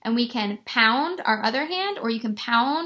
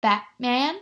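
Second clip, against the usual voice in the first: "Batman" in two beats, rising at the end; split into syllables. Shown as a demonstration. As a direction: break "Batman" as BAT, MAN, tune lifting. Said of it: "so we can use our chin".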